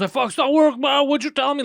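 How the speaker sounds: high-pitched, loud imitation of an exasperated friend; mock condescending friend. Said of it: "he's condescending to me".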